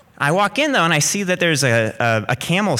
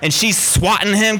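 normal talking voice

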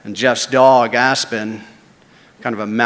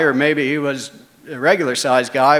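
normal talking voice

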